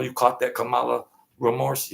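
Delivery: ordinary talk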